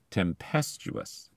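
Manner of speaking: tempestuous said slowly, tem-PEST-uous; slow down and hit PEST in tempestuous; stress on second syllable. Shown as a demonstration. Podcaster showing accent pulled closer, tempestuous stressed on PEST. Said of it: "endings pull the accent closer".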